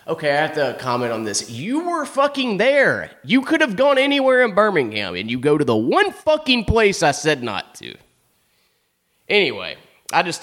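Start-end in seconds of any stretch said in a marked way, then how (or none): 1.5-7.0 high, indignant disbelief at her; can't believe she was there; exasperated outburst. Real, not acted. Ranting to the audience about the stalker, exasperated and high.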